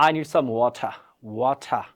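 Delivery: "water" with a crisp aspirated T; British standard T in "water"; British pronunciation. Shown as a demonstration. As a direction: say "water" as "wo-tha", no R. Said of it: "ব্রিটেনের স্ট্যান্ডার্ডটা শেখেন তাহলে কি হবে".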